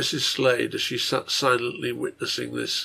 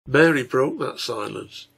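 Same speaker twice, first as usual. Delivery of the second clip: usual speaking voice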